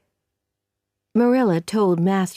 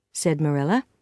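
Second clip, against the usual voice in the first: ordinary delivery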